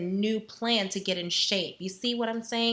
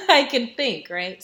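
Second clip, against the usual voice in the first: laughing; 3 semitones higher, 8 dB louder than usual. Real, not acted. Laughing about being hydrated.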